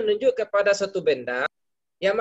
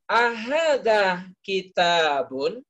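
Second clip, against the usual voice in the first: slow rising question, stress on "Ahadza"; nada tanya; much slower than usual. Shown as a demonstration. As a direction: ask "Ahadza kitabun?" slowly, rising at the end. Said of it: "gitu cara bertanyanya". (pertanyaan pelan dengan nada naik)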